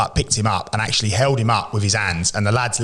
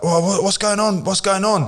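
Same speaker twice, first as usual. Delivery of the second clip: high, mock just-woken stammer; fake sleepy; 10 semitones higher than usual. Caught sneaking in, faking just woken up. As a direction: pretend you were asleep. Pretending to wake up.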